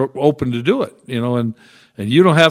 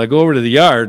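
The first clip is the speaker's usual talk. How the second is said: ordinary talk, nothing marked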